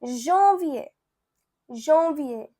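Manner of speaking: janvier as French "zhon-vyay"; French pronunciation. Shown as a demonstration. Teacher showing learners January in French, careful French janvier. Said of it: "You can say".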